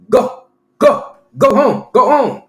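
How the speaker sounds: loud, high imitation of a man shouting; mock yelled order. Neighbor ordering him to go home, imitated high yell. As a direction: yell it as short, mocking commands.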